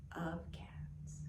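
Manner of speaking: whispered softly; trailing whisper. Acted. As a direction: let the last words fade into a whisper. Story rhyme trails off.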